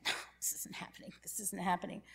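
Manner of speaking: fast, breathless whisper through tears; whispered denial. Retold or imitated. Moved to tears in church, whispering in denial. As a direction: murmur it to yourself, fighting the tears. Crying during the song.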